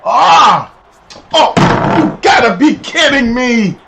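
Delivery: real exasperated yell, slow on the last words; frustrated gaming outburst. Real, not acted. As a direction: yell at the screen in disbelief. Reacting to a game play.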